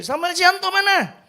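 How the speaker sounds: high-pitched call for someone; imitated calling out. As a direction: mimic summoning a relative from the corridor. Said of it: "Saya dipanggil".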